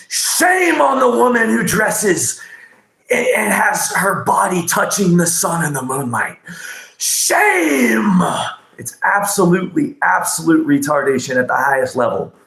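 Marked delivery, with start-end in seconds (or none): 0.1-8.2 mock outraged shout. Mocking conservative Christians who shame topless women, high scornful shout. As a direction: play a preacher crying shame, pitched way up.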